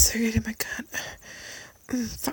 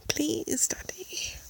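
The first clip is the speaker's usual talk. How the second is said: begging; 6 semitones higher, 5 dB quieter, much slower than usual. Acted. Begging in the roleplay.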